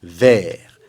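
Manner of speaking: "Vert" ending on the r, no t; silent final T. Shown as a demonstration. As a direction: say "Vert" only as far as the r. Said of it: "On prononce jusqu'au R".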